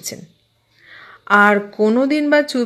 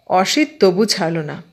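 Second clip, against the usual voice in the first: ordinary delivery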